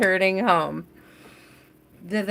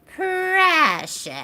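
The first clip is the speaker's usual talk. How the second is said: high hissing "Precious" like Gollum; 10 semitones higher than usual. Pretend. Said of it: "say that with a lot of extra S".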